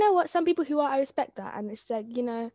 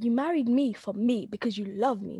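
usual speaking voice

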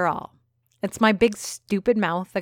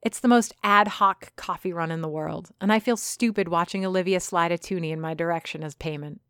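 ordinary delivery

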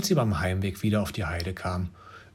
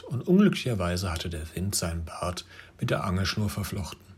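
plain reading voice

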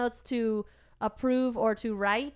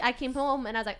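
ordinary talk, nothing marked

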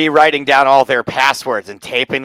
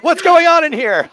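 higher, alarmed doctor; 11 semitones higher than usual. Retold or imitated. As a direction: burst in alarmed. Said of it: "They're like".

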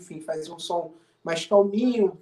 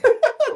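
sudden high burst of laughter; 8 dB louder than usual. Real, not acted. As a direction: laugh out loud at the joke. Laughs at long album name.

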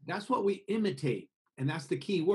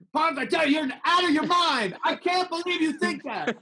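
high, loud shouting at a friend; mock argument shout; 13 semitones higher, 5 dB louder than usual. Shown as a demonstration. Arguing with Parth, shouting high and loud. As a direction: yell like you're in a heated quarrel. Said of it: "we would be shouting at each other".

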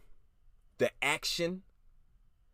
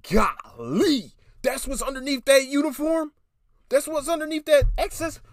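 high, loud, breathless gush; squealing with delight, pitched way up; awestruck gushing; 11 semitones higher, 7 dB louder than usual. Real, not acted. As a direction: gasp out your amazement at her. Gushing over actress's looks.

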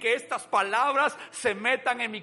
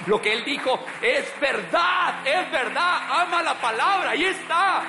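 higher, loud shouted sermon; preaching at full force; 5 semitones higher, 4 dB louder than usual. Real, not acted. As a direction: build to a fervent peak.

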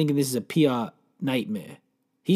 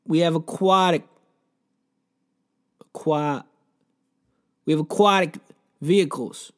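hammed-up car dealer pitch; cheesy ad read; 2 semitones higher, slower than usual. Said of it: "here's the ad".

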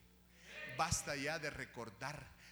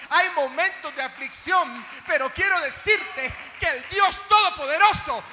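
loud, high, ringing pulpit declarations; preaching at full force; 8 semitones higher, 19 dB louder than usual. Acted. Preacher assuring the afflicted congregation, shouting at full force. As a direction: proclaim it like a revival preacher.

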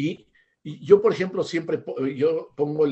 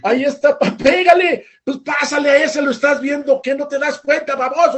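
much higher, loud shouting at the players on TV; frantic fan shouting; 12 semitones higher, 11 dB louder than usual. Retold or imitated. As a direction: scream at the screen like a furious football dad. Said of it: "y le gritan a la tele". (aficionado furioso gritándole a la tele)

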